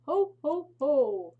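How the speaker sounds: big, loud, high jolly Santa laugh; Santa's ho ho ho. Acted. Santa laughing from the chimney as he leaves, loud and jolly. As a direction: boom it out like Santa.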